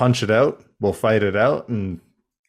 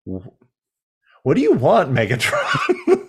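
laughing mock Optimus; 5 semitones higher than usual. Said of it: "And I'm like".

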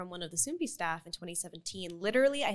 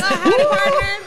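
cowboy greeting; 13 semitones higher, 15 dB louder, slower than usual. Pretend. Greeting the Korean Cowboys podcast.

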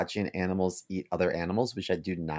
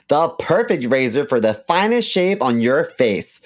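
upbeat sponsor ad read; 5 semitones higher, 6 dB louder than usual. Acted. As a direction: sell it like a radio spot. Reads a sponsor ad.

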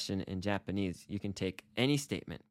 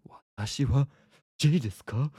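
higher, louder, hammed-up self-questioning; mock identity crisis; 4 semitones higher, 6 dB louder than usual. Teacher joking that he doubts who he is, mock panic. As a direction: question your own name in comic panic. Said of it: "having this identity crisis where I'm like".

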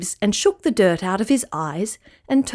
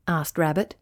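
usual speaking voice

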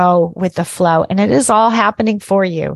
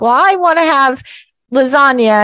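whiny family member; 6 semitones higher than usual. Retold or imitated. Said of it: "a family where like".

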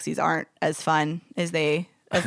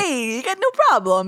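higher, put-on Brooklyn guy; Brooklyn accent; 5 semitones higher than usual. Traveler teased about her accent replays the driver, hammed-up Brooklyn. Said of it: "That's always your accent".

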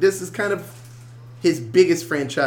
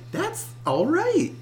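high, slow breezy voice; 12 semitones higher, slower than usual. Retold or imitated. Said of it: "so now it's like".

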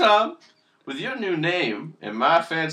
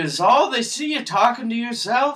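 ordinary talk

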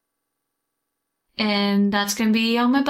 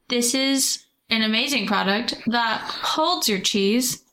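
ordinary talk, nothing marked

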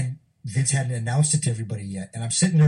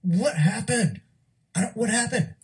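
much higher, slow desperate plea; distraught retold cry, pitched way up; pleading in shock; 11 semitones higher, slower than usual. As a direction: beg for an answer, voice cracking high. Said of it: "was like".

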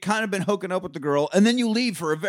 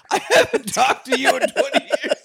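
higher, louder mock ex with a laugh; 8 semitones higher, 5 dB louder than usual. An old girlfriend greets a long-lost ex, laughing mock shock. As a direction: play the stunned ex through laughter.

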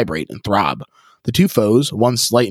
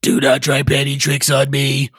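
sneering; 4 semitones higher than usual. Acted. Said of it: "the voice sneers".

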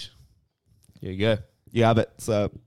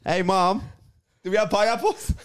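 put-on high-pitched child asking mom; high kid voice; 10 semitones higher than usual. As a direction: ask mom like a little kid.

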